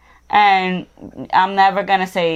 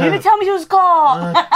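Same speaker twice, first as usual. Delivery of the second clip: high, laughing Boston attempt; hammed-up mock Boston, pitched up; bad Boston accent; 9 semitones higher, 4 dB louder than usual. Co-host joining in on the accent joke, high mock Boston. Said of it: "No one can do it".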